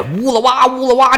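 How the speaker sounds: high, held trumpet imitation; imitated horn call. Storyteller mimics the army's horn, high horn call. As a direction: sing out the blare of a war horn. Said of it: "这喇叭一吹".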